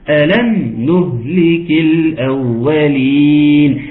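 ألم نهلك الأولين chanted slowly as a real question; questioning tune. Shown as a demonstration. Teacher showing the verse as a question. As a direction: make ألم sound like asking. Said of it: "حسسني بالسؤال".

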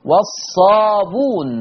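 والصابون with no hamza; Warsh reading. Shown as a demonstration. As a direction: say والصابون as "was-saabuun". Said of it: "قرأ بضم الباء وحذف الهمزة".

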